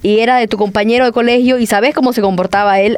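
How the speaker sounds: ordinary talk, nothing marked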